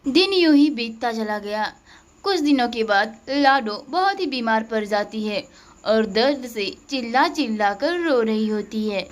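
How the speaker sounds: ordinary delivery, nothing marked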